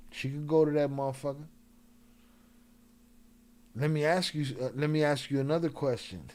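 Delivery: usual speaking voice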